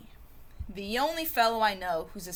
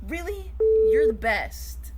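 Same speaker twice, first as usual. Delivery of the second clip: delighted; 6 semitones higher, 8 dB louder, slower than usual. Acted. Thrilled at gift of scarf.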